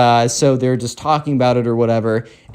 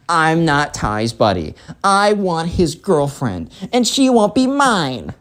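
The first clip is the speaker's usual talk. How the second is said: put-on petulant spoiled kid; 3 semitones higher, slower than usual. Spoiled kid tells the mom he wants the girlfriend, petulant. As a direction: spell it out slowly, rising to a whine.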